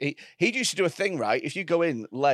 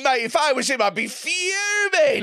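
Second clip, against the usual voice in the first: shouting; 8 semitones higher, 4 dB louder than usual. Real, not acted. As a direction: shout it in disbelief. Mock outrage, shouted.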